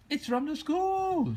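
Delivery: slow, high terrified shriek. A narrator's reading. Woman fleeing the bear, shrieking in fear. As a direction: scream it in fright. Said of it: "she shrieked".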